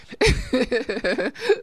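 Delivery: a high bursting laugh after "never mind"; embarrassed laugh. Real, not acted. Radio host laughs off her own half-said joke, high bursting laugh. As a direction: laugh it off, caught out.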